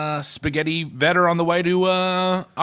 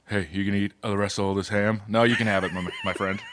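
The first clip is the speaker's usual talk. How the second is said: lower, softer big-man voice for Shaq, almost [name] British; 8 semitones lower, 5 dB quieter, faster than usual. Pretend. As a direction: play Shaq low and quiet, a hint of Liverpool. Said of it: "Shaq sounds a lot like [name]".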